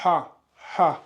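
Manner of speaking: "Ha" with a strong pharyngeal H; two separate Arabic "ha" syllables; throat-made H. Shown as a demonstration. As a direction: push "Ha" from the throat, raising the Adam's apple. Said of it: "la pomme d'Adam remonter un peu".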